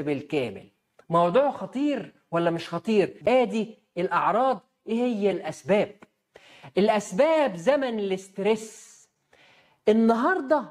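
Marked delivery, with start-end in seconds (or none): none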